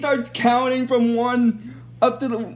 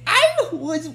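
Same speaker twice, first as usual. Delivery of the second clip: much higher squawky bird voice; hatchling Tenga; 12 semitones higher than usual. Retold or imitated. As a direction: squeak it out like a freshly hatched bird. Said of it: "one of them says".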